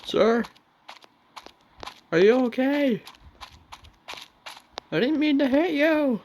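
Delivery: alarmed calling out. Real, not acted. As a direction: shout over in alarm. Driver calls to man he hit.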